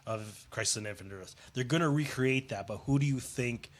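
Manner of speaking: usual speaking voice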